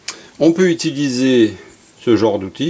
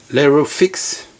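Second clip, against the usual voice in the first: English accent; 4 semitones higher, slower than usual. Shown as a demonstration. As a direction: say Aerofix with a mock English accent. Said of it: "avec un superbe accent anglais". (prononcez Aerofix avec un accent anglais exagéré pour rire)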